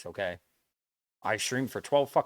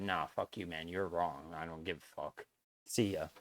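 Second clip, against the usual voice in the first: muttered, much softer mock blow-off; dismissive brush-off; 6 semitones lower, 8 dB quieter than usual. Mocking how the viewer might have stormed off, dismissive mutter. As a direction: toss it away like you don't care. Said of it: "and said".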